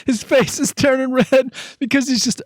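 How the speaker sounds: very high squeal, helpless with giggles; real hysterical laughing voice; crying with laughter. Real, not acted. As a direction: gasp out each phrase between laughs. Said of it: "I'm crying right now".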